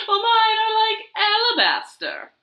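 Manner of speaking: high, loud haughty hen; vain hen. Acted. Zinnia outdoing Dot, high haughty hen. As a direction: toss the boast back at her.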